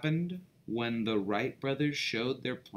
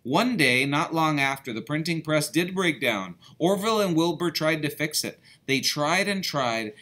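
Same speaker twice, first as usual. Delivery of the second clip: ordinary delivery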